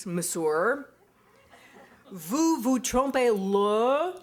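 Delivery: very slow, over-enunciated French, each syllable laid out; painstaking American French, slow and deliberate; slow careful French. Retold or imitated. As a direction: spell it out for a foreigner on the phone, word by word, with a laugh. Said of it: "his slower, more carefully enunciated".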